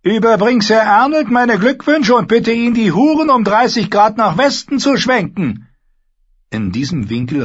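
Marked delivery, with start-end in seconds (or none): 0.0-5.7 high, loud officer's command; commanding order. Acted. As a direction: bark out the orders. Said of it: "sagte er". (befehlender Ruf)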